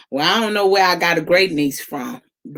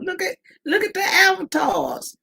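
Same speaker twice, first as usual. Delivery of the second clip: laughing; 11 semitones higher than usual. Real, not acted. Laughing at lookalike avatars on stream.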